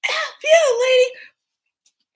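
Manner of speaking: high, loud retold outburst; acted scream of defiance; defiant yell. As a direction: yell back at the monster. Retold fight with monster nurse.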